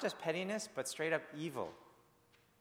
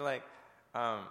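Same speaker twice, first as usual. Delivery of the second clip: usual speaking voice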